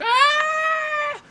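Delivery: piercing high monster shriek; supernatural shriek. Acted. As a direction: shriek like an unearthly creature. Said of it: "they all begin going".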